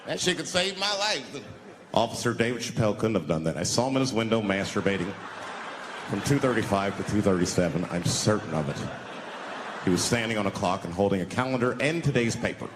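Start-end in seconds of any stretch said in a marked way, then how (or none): 1.9-12.7 lower, formal cop witness voice; police officer testifying. Retold or imitated. Cop witness vouching for the comic's alibi, formal. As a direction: report it like a sworn officer.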